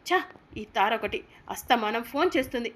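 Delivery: ordinary delivery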